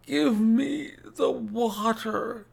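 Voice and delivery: slow, very high, strained gasp; gasped plea. A narrator's reading. Sick man begging his visitor, high gasping plea. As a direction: plead for it like a failing invalid. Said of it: "he gasped".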